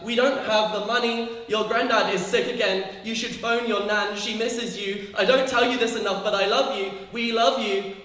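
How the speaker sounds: guilt-trip them gently but loudly; family on the phone. Pretend. Family member pleading with the distant child, loud.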